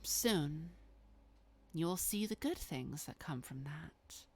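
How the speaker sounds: lightly voiced vampire, coolly persuasive; measured, understated persuasion with pauses; Babs. Malkavian justifies the favour to an angry guest, lightly acted persuasion.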